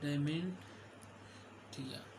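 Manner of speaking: Dementia very slow and soft, split by a long pause; slow dictation. Shown as a demonstration. Teacher dictating a medical term to students, dementia said slowly and softly in parts. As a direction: spell out dementia softly, pausing long before the last syllables.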